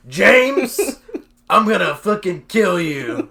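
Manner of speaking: loud, high mock threat; hammed-up angry shout. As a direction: pretend you're about to fight him. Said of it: "That's what it sounded like".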